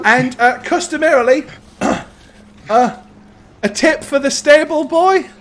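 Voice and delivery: high, hesitant lad's voice; young stable boy. Acted. Stable boy hinting at a tip, hesitant high boyish voice. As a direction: pitch it up, with ums and pauses.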